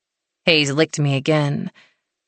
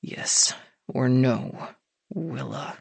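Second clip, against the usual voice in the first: low and slow through gritted teeth; strained, teeth clenched; 4 semitones lower, 7 dB quieter, much slower than usual. A narrator's reading. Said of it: "through gritted teeth".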